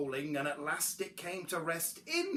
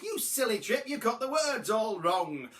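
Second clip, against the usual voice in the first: mildly raised scolding; restrained sibling yell; 5 semitones higher, 4 dB louder than usual. A narrator's reading. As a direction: tell him off in a higher voice. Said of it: "the others yelled".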